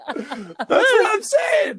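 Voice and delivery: high, slow laughing shout; can barely get it out for laughing. Real, not acted. Cracking up at the co-host's cockney joke.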